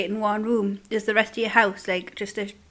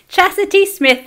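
higher, laughing Geordie take on the name; Geordie accent; 8 semitones higher, 6 dB louder than usual. Shown as a demonstration. Repeating the viewer's name in Geordie, laughing. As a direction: say the name again in Geordie, giggling. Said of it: "that's how I say your name in Geordie".

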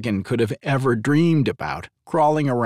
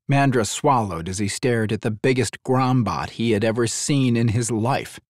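ordinary delivery

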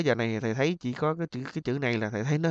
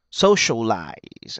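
slow Socialize, SO punched; stress on first syllable; 2 semitones lower, 4 dB louder, much slower than usual. Shown as a demonstration. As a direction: lead hard on SO, stretch out Socialize. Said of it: "âm một". (nhấn âm một Socialize, kéo dài)

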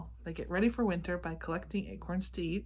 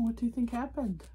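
usual speaking voice

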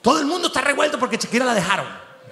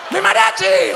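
higher, louder call to the band; shouted call; 8 semitones higher, 4 dB louder than usual. Retold or imitated. Heartbroken listener shouts to the band, loud and high. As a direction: cry out to the mariachis.

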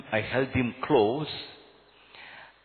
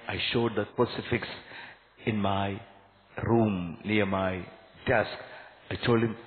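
usual speaking voice

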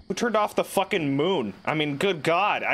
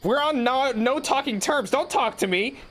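annoyed at Siri; 10 semitones higher than usual. Real, not acted. Scolding Siri for butting in, high and indignant. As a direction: tell off the phone assistant.

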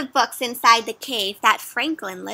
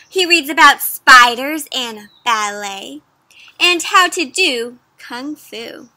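storybook read-aloud; 5 dB louder than usual. A narrator's reading. Reads story text aloud.

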